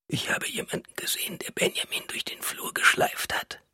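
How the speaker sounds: faint, voiceless whisper; almost soundless. A narrator's reading. Wife tells what she saw, almost soundless whisper. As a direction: whisper it so it barely carries. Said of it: "sagt sie fast lautlos".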